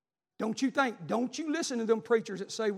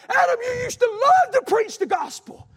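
loud pleading shout, higher pitched; God calling Adam; 13 semitones higher, 11 dB louder than usual. Acted. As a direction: plead with a lost child at full voice. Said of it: "He's saying".

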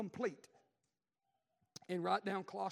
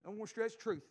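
usual speaking voice

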